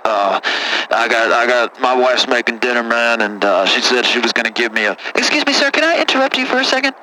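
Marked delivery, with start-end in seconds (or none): 0.0-5.0 put-on much lower, rough-talking good ol' boy; deep caller voice. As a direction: play a low-voiced country caller.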